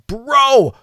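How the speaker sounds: urgent call. Retold or imitated. Urging a friend to pull the pic, loud and high. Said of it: "I'm like".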